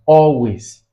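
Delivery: slow "always", AL drawn out; teaching demo of "always" with a weighty AL; stress on first syllable. Shown as a demonstration. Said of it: "you have it on the first".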